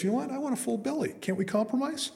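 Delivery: very high-pitched bargaining bear; talking bear. Acted. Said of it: "And the bear goes".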